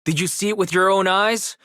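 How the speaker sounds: affronted demand; indignant challenge. Acted. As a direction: throw it back at him, offended. Accused man challenges accuser.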